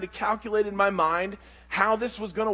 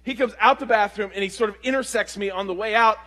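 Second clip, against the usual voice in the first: ordinary delivery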